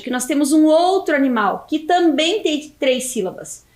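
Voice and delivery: ordinary talk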